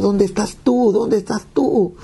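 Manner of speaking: very high, drawn-out plea; re-enacted wail without extra volume; retold crying out. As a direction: call after God in anguish, stretching the end. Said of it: "comienzo a gritar y le digo".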